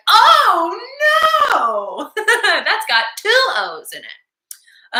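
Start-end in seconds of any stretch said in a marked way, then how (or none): 0.1-2.1 loud high cry of oh no; mock dismay. Shown as a demonstration. Teacher showing the O sound, a loud mock oh no. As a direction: act out a big oh no. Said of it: "O, like".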